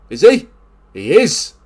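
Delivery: high, loud eager question; excited shout. Real, not acted. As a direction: leap on the chance. Waiting on a sending off.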